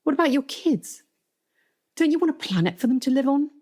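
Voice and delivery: mildly acted indignant plea; pleading challenge. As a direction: press the boss with an urgent question. Zandy pleading with her boss.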